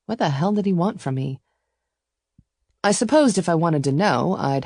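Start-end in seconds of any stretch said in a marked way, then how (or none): none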